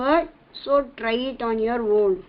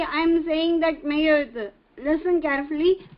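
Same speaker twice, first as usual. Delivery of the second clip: ordinary talk, nothing marked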